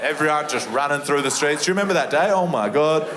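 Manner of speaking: usual speaking voice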